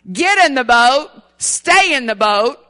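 chanting with the congregation; call and response. Shown as a demonstration. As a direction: lead the crowd in the slogan. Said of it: "say it with me".

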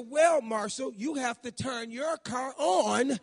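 loud, slow, talking down; condescending punchline. Retold or imitated. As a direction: spell it out slowly like to a child. Wife's punchline mocking husband.

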